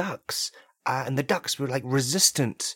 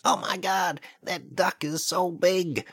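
alarmed cry at a giant duck, slow and raised; hammed-up horror, a bit higher; mock panic; 6 semitones higher, slower than usual. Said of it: "they're gonna hear this".

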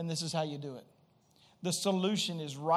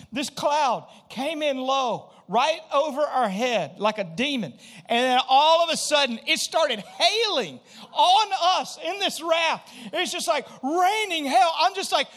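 usual speaking voice

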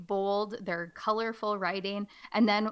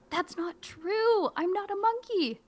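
very high, slow indignation; indignant protest; 10 semitones higher than usual. Acted. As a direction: whine in protest. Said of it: "play it up and be funny".

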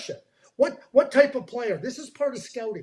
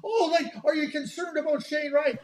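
higher-pitched mimicked doubter; mock questioning; 6 semitones higher than usual.

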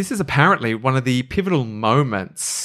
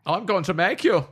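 lightly voiced German trooper; a hint of the trooper's threat; mild mock trooper threatening Harry Power; 4 semitones higher, 3 dB quieter than usual. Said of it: "So I'll play the German troopers".

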